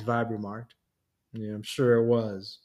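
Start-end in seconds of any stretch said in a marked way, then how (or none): 1.3-2.6 dry, mock-agreeing sarcasm; sarcastic. A narrator's reading. Brandon to Vibe, doubting the Queen's kindness, sarcastic. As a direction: say it like you don't believe it. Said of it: "Brandon said sarcastically".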